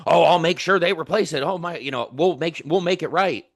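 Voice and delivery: mock eager-to-please; over-eager promise. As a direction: rush to reassure, overdoing the eagerness. Mocking an over-eager agent's promise.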